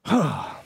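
long, audible exhale; exasperated sigh. Real, not acted. At the end of his rope, weary sigh. Worn out after the rant.

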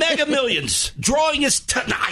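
high, hyped-up lottery announcement; mock excitement. Host hyping the lottery drawing, mock excited. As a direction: build to a big exclamation. Hypes lottery as big news.

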